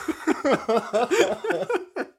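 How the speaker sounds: hohes, lautes Auflachen; lauter hoher Lachanfall. Real, not acted. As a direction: platzt hoch vor Lachen heraus. Lachen nach verlegenem Wort. (hoher, lauter Lachanfall)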